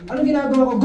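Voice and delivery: usual speaking voice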